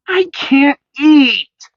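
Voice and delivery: high, slow, loud and furious; a boy refusing breakfast in anger; angry child. A narrator's reading. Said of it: "said Sammy angrily".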